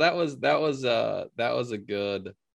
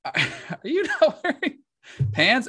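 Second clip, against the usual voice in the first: high, disbelieving mock question; imitating a baffled coworker; incredulous question. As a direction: ask it half laughing in disbelief. Tim's retold question.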